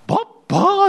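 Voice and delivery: put-on high little child calling ば、ばー; high, babyish toddler call. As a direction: squeal ば、ばー like a small child. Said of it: "って言うんですよ".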